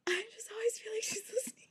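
fast, high paranoid whisper. Acted. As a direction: whisper it as if overheard.

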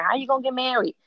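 a hint of a mother's pointed questioning; lightly voiced nagging mother. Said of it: "Our mothers telling us".

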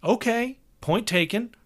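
brash, cheerful tour manager; hearty road manager. Acted. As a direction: play the chummy older road manager. Hogarth voice again.

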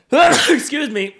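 loud booming sneeze, then excuse me; big sneeze; dad sneeze. Real, not acted. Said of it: "dad sneeze".

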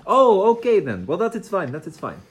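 put-on Italian-accented ringmaster. Plays the ringmaster.

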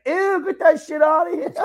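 high, loud mock kid squeal; imitated disgusted kid. Godson rejecting a free Messi jersey, imitated disgusted kid. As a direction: squeal it like a revolted little kid. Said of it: "the kid's like".

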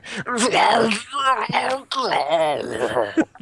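high, drawn-out garbled wail imitating a bad accent; imitated accent noises. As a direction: wail nonsense as a mock accent. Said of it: "recently is taken to going".

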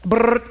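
buzzing ring sound; phone ring imitation. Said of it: "my phone rings".

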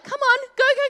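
high, loud urging; urgent mum. Retold or imitated. Mum urging daughter toward the angry ram, loud and high. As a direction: hurry them on, raising the voice. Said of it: "my mum's like".

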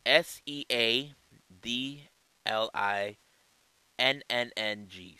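slow, letter by letter; spelling it out. Shown as a demonstration. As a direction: spell each letter out slowly. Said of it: "the promotion known as Seedling".

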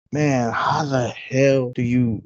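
usual speaking voice

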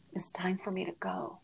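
faint, breathless whisper of a dying man. Retold or imitated. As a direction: barely voice it, just air. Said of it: "I'm gonna get so, so emotional".

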